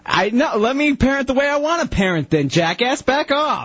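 mock angry snap. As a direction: bark back at the cohost in mock rage. Defending parenting, snapping at cohost.

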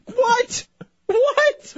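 very high-pitched, incredulous "what?". Real, not acted. Host baffled by the engineer's excuse, incredulous. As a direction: squeal it in disbelief.

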